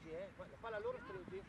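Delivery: ordinary talk, nothing marked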